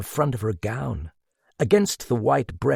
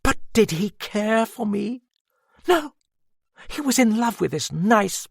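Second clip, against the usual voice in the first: higher, faraway, drained woman recalling; a lightly voiced woman lost in memory; dazed Madame; 5 semitones higher than usual. A narrator's reading. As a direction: Madame tells the detective about Edouard, dazed and drained. Madame's voice held.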